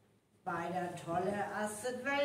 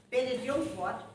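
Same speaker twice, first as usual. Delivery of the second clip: normal talking voice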